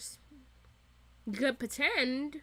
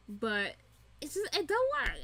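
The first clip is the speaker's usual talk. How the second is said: normal talking voice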